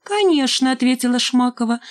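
high-pitched breezy reply; coy, smug. A narrator's reading. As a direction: answer airily, as if it is obvious. Шмакова coyly answers Димка, gloating. (высоким жеманным голосом)

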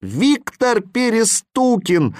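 high, slow accusing cry; chorus answer. A narrator's reading. Boys and animals answer Viktor together, accusing cry. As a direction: call out the culprit together. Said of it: "хором ответили мальчишки".

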